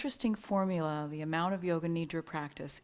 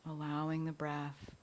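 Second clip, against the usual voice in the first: calm meditation guide, short phrases with long pauses; unhurried yoga nidra guidance, leaving space for each breath; guided relaxation. Real, not acted. Teacher easing listeners into yoga nidra rest, calm guided relaxation.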